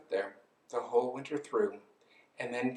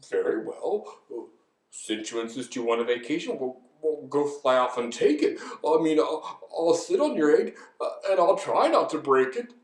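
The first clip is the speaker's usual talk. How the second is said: hesitant, put-on elephant voice; reluctant elephant; 6 dB louder than usual. Elephant agrees to mind the egg, hesitant consent. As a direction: give in with fumbling hesitations.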